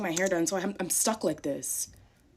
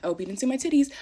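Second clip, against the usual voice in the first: ordinary talk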